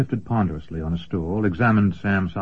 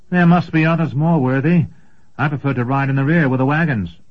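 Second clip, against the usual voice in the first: put-on higher, nervous young man's voice; reluctant young apprentice; 6 semitones higher, 4 dB louder than usual. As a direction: plead to stay at the rear.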